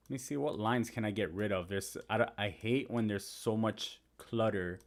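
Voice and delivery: ordinary talk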